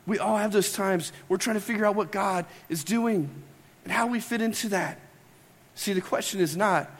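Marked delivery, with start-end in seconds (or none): none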